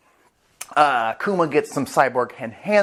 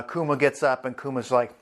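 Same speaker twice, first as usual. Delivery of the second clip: ordinary talk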